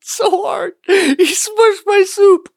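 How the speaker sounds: high, slow squeal through laughter; laughing mock complaint. Co-host playing along about the thrown pizza, laughing mock whine. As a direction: wail it slowly while cracking up.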